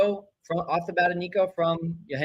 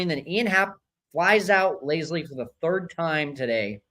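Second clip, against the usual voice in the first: usual speaking voice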